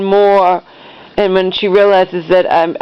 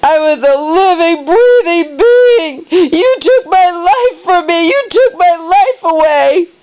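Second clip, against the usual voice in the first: high, tearful drawn-out wail; crying out at a much higher pitch; bawling; 11 semitones higher than usual. Retold or imitated. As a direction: imitate a mother wailing in accusation. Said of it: "like, bawling".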